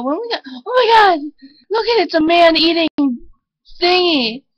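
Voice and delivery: mock terror at a dog; panicked. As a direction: blurt it out in fright. Sees scary dog, panics.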